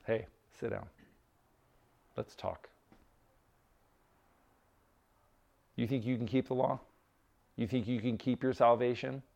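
hushed, low, unruffled elder; quiet confidence. Acted. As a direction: play the wise older guy, soft and sure. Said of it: "just absolutely confident in their faith".

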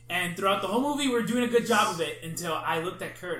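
ordinary talk, nothing marked

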